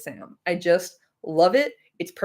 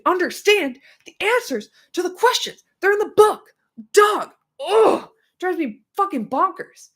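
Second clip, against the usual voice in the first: worked up; 10 semitones higher than usual. Real, not acted. Streamer venting at fans who won't read, high and exasperated. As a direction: hammer home that it's in the book. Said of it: "I'm getting so worked up".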